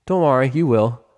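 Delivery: brief reassuring line in a light voice; lightly voiced pony. Character voice in reading.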